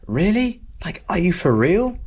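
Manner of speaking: high, rising are-you-serious tone; disbelieving surprise. Shown as a demonstration. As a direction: react to something unbelievable. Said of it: "it's basically like saying".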